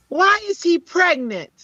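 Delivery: put-on high-pitched, blurted child's question; imitated loud child. Pebbles asks about a man in the store, loud child. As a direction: pipe up like a tactless little kid. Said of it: "'cause she was so loud".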